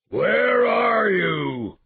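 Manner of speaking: very loud, high, long-drawn bellow; hoarse animal-like roar. A narrator's reading. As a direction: roar it out like a brutish savage, stretching every word. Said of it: "he roared in a hoarse, animal-like voice".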